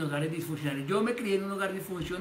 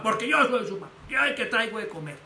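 bossy father; 3 semitones higher, 3 dB louder than usual. Retold or imitated. Father asserting authority over his son, stern and loud. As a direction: lay down the law as the dad.

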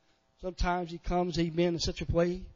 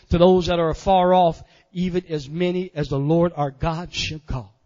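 measured, emphatic verse swelling louder midway; preached scripture recitation; 6 dB louder than usual. Acted. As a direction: declaim the scripture phrase by phrase.

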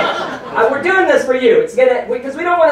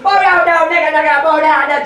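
imitated scream; 5 semitones higher than usual. Comedian imitating his screaming father-in-law on the train. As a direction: yell it like a frantic old man. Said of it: "screaming at the top of his lungs".

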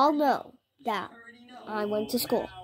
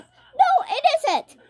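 indignant protest; 5 dB louder, slower than usual. Retold or imitated. Kid insisting to principal.

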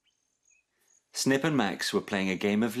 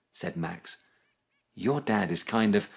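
animated storytelling with lively character voices; children's story reading; 3 semitones higher than usual. A narrator's reading. Narrator telling children about Snip's dad, animated storytelling. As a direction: read a picture book aloud with expressive swoops. Reads a picture book to children.

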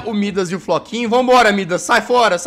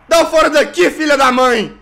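triumphant escape shout; 7 semitones higher, 7 dB louder than usual. Real, not acted. Escaped the chaser.

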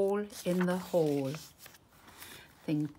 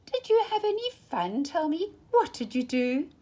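much higher, bright questioning mom voice; cheery mother; 9 semitones higher, 4 dB louder than usual. Acted. Mother greets the children on coming home.

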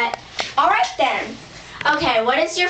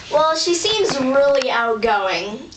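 ordinary talk

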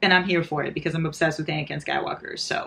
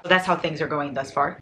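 ordinary delivery

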